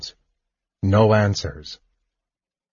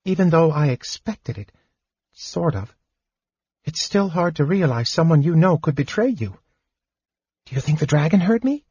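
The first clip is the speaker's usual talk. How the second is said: put-on higher, lighter young kender voice, shaken; kender voice, upset; 7 semitones higher than usual. A narrator's reading. Tass apologizing to Fizban in the dark, shaken. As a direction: voice the kender fretting after the betrayal.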